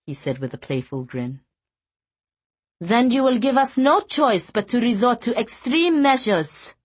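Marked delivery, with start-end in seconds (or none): 2.8-6.8 put-on Norwegian accent. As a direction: threaten him in a Norwegian accent. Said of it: "the Norwegian replied".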